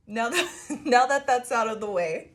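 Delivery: can barely get it out for laughing. Real, not acted. Giggling through her words.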